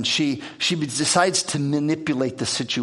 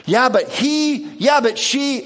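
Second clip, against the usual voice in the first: higher, louder dismissive brush-off; 8 semitones higher, 4 dB louder than usual. Retold or imitated. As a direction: brush them off as if they aren't there. Talking past someone present.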